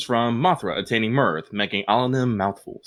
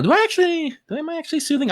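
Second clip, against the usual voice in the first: surprised; much faster than usual. Real, not acted. Surprised at being called soothing.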